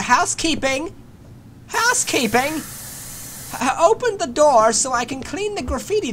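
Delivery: high singsong housekeeping call; mock maid. Gamer pretending to be maid at monster's door, high chirpy. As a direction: play a chirpy hotel maid. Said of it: "I'm a freaking maid".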